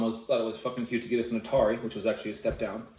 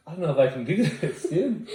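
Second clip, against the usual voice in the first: laughing through the burn; 5 semitones higher, 4 dB louder than usual. Real, not acted. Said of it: "I'm starting to cry a little bit here".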